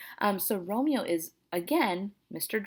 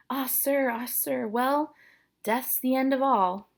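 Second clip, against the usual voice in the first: higher, breath-led acted lament; sighing nurse; 6 semitones higher, 3 dB louder, slower than usual. Nurse consoling distraught Romeo, sighing high voice. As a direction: sigh it out to the young man.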